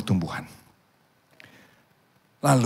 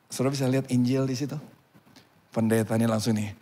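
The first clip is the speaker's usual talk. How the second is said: ordinary talk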